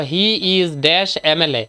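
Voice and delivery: slow, spaced-out exam sentence; slow dictation. Shown as a demonstration. Teacher dictating a fill-in-the-blank question, slowly and deliberately. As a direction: read it out word by word, drawing each out.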